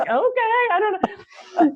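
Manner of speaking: high, slow, puzzled okay; baffled shrug. Retold or imitated. Teacher answering a stunned student, high baffled shrug. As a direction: sound lost and amused. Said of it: "And I'm like".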